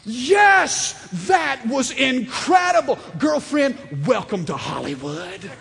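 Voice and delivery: excited shouted cheer, half laughing; wild cheering. Retold or imitated. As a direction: cheer like a proud dad at a talent show. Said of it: "I went nutso".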